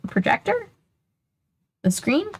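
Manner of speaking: high rising puzzled question. Real, not acted. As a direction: ask it in real disbelief. Reader puzzled by the text.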